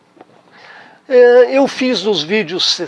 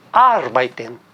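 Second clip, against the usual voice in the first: arbeiten, lower, with the weight on AR; stress on first syllable; 6 semitones lower, slower than usual. Shown as a demonstration. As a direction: hit AR in arbeiten, keep the rest light. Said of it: "proparoxítono".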